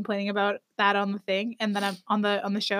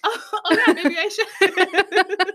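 laughing sheepish reply; 8 semitones higher, 6 dB louder, much slower than usual. Retold or imitated. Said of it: "And I'm like".

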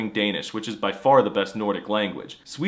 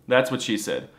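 ordinary delivery

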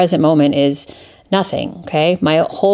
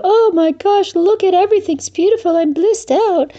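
much higher, fast, airy mock rapture; mock blissed-out voice; 12 semitones higher than usual. Mocking the speakers, a much higher gushing blissed-out voice. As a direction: parody a gushing spiritual type. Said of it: "close my eyes and go".

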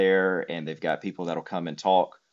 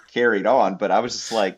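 ordinary talk

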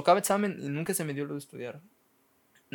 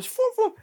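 whooshing noise; 6 dB louder than usual. Retold or imitated. Imitating things flying on cover.

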